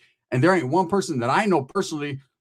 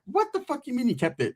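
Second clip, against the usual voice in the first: high, disbelieving squeal; can't believe he kept it; incredulous; 8 semitones higher, 5 dB quieter than usual. Retold or imitated. Narrator squeals in disbelief at his friend's kept stone.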